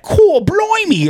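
hammed-up Cockney; Cockney accent. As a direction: put on a broad Cockney accent. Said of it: "like, accent".

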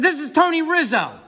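high, loud phone yell; shouting his name. Retold or imitated. Referee giving his name on a bad line, shouting. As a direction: yell it to get through bad reception. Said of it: "it's difficult reception".

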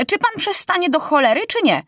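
high-pitched exasperated demand; boy king at the end of his patience; furious impatience. A narrator's reading. King loses patience with minister.

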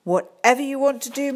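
besotted. Acted. Smitten head teacher.

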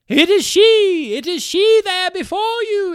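loud, high, overjoyed cry; choked with rapture. Acted. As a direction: reveal the daughter in rapture. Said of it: "her voice half choked with rapture".

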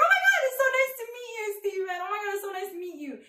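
high, fast mock-mom greeting, easing down; gushing mother. Mother greeting boyfriend Steven, high, fast and gushing. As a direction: start squealing and rattle on, softening as you go. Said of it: "She's like".

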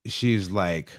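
ordinary talk, nothing marked

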